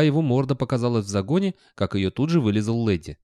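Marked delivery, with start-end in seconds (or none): none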